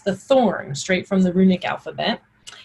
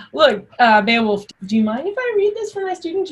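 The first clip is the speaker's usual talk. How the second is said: high, giggly and amused; laughing; 7 semitones higher than usual. Real, not acted. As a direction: laugh while scolding playfully. Laughing at the interrupter.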